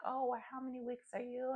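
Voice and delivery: high, soft imitated nurse voice; reassuring nurse. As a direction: imitate a nurse gently checking in. Retold nurse calming the crying patient.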